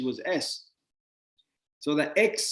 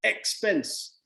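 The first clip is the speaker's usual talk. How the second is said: mock disdain; 5 semitones higher than usual. Shown as a demonstration. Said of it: "Or you can be an expense".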